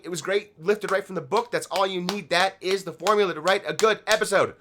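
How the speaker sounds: usual speaking voice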